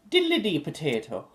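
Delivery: playful Irish accent catchphrase. Shown as a demonstration. Player showing a resetting phrase for Irish, Irish accent. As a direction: put on a stock Irish lilt. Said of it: "I have one for Irish".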